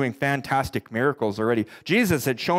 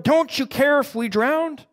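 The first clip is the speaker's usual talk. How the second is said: panicked plea; 10 semitones higher, slower than usual. Acted. Disciples' desperate cry quoted.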